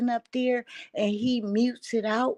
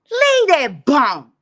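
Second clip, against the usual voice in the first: loud slow order; 5 semitones higher, 12 dB louder, slower than usual. Retold or imitated. Telling Ashley to leave him.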